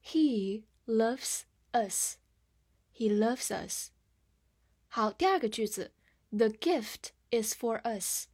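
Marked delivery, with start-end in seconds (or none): 0.0-2.2 slow, word-by-word model; slow reading. Shown as a demonstration. Teacher models a short example for learners, slowly. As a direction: stretch out each word with small gaps. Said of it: "慢慢来读".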